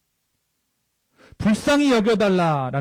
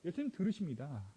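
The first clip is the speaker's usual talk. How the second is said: without microphone; 3 semitones higher, 18 dB quieter than usual. Shown as a demonstration. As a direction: call out unamplified, away from the mic. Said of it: "제가 마이크 쓰지 않고". (마이크 없이 부르는 소리)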